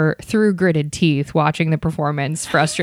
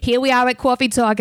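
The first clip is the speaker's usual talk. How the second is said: put-on New York accent; 6 semitones higher than usual. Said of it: "do a New York accent like that".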